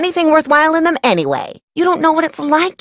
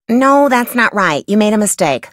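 ordinary talk

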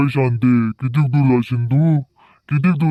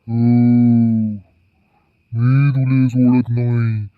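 ordinary talk, nothing marked

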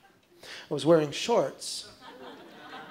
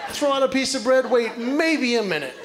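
higher, slow, savoring the plan; slow building setup; 6 semitones higher, slower than usual. Acted. Comic laying out his duck plan to the crowd, slow and higher. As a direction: draw out the lazy plan.

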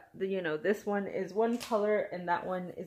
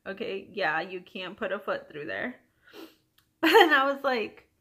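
normal talking voice

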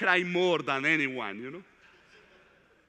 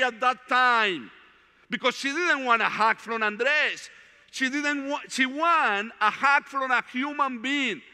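earnest preacher building toward the point; preaching testimony; 3 semitones higher than usual. Acted. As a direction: testify to the brothers with conviction. Preacher addressing brothers.

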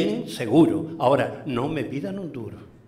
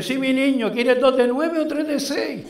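put-on high-pitched old shopkeeper voice; high little-old-lady impersonation; 10 semitones higher, 4 dB louder than usual. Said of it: "Lo coge la viejita y dice".

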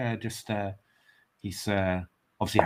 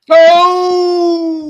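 sudden high yelp of excitement; excited shout; 15 dB louder than usual. Real, not acted. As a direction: yelp as the move lands. Reacting to a winning move.

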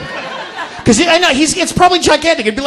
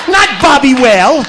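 ordinary delivery

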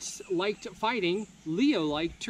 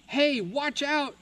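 higher, slow warning call; 8 semitones higher than usual. Acted. Scared townsfolk warning Leo, higher call. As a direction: shout a warning from the windows. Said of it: "the people cried".

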